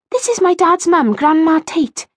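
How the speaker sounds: put-on high-pitched girl character; young girl's voice. A narrator's reading. Kirsty introduces her gran to Rachel, girlish.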